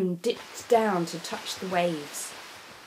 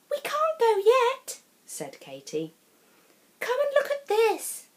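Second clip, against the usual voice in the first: put-on high, girlish and insistent; eager Katie; 14 semitones higher than usual. A narrator's reading. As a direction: call him over excitedly. Katie answers Tom.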